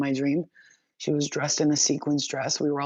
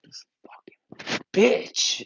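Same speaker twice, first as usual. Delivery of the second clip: high slow whispered curse; spirits' hiss; 7 semitones higher, much slower than usual. Retold or imitated. Spirits annoyed at her prayer, slow whispered curse. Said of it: "they're like".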